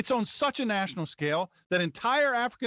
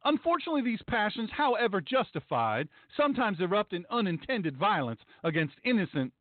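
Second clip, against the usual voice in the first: ordinary delivery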